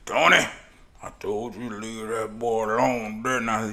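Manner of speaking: a touch of a stern dad; stern father warns the boy; scolding father. Retold or imitated. Father scolding son in church.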